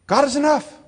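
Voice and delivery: preacher declares the truth loudly and joyfully; enthusiastic proclamation. Shown as a demonstration. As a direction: proclaim it triumphantly. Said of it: "a little more enthusiastic".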